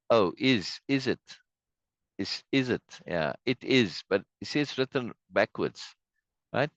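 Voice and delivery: ordinary talk, nothing marked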